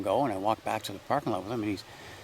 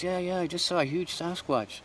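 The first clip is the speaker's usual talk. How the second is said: higher, slower, a touch of retold fright; frightened hiker; 8 semitones higher than usual. Hiker blurting to the walker what he saw, mildly frightened. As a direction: imitate the shaken hiker, pitched up and slow. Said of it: "he's all freaking out".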